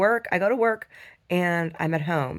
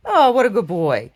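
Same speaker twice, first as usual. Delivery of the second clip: praising the dog; 2 semitones higher, 8 dB louder, slower than usual. Real, not acted.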